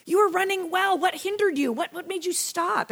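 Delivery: high-pitched exasperated pleading; exasperated Paul. Retold or imitated. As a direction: plead like a let-down teacher who expected better. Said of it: "Paul was just kinda exasperated".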